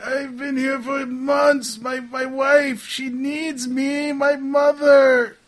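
high, drawn-out wailing shout; slow, high, hammed-up cry for help; trapped man's plea. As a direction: beg desperately to be let out. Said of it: "and say".

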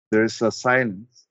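normal talking voice

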